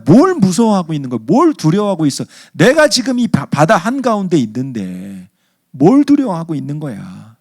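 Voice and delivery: high, loud indignant rebuke; exasperated scolding. Acted. Jesus to frightened disciples, indignant scolding. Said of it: "제자들을 꾸짖으셨다 그랬어요".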